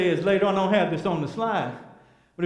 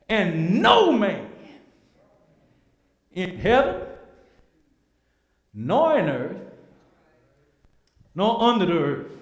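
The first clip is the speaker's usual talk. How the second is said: solemn scripture reading; 3 semitones higher, 6 dB louder than usual. Acted. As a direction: proclaim each phrase from the pulpit. Reads scripture with force.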